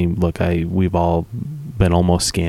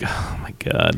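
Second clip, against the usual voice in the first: whispered; 3 semitones lower, 6 dB quieter, slower than usual. Real, not acted. Mocking aside while ranting.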